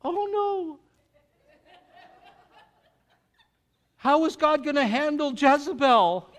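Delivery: high, hammed-up fearful wail with a laugh; mock panic. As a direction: play a scared prophet for laughs. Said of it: "And he goes".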